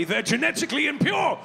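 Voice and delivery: hammed-up fanatic yell; mock rally shout. Joking about a eugenics rally, mock shout. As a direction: bark it like a rally demagogue.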